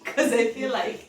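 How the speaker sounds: slow words broken by real laughter; amused drawn-out giggle; laughing. Real, not acted. Laughing at her own claim.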